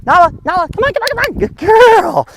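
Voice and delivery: very high, excited squeal to a dog; happy Mickey Mouse voice. Shown as a demonstration. Trainer winning the dog's attention, happy Mickey Mouse voice. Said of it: "a happy voice or Mickey Mouse voice".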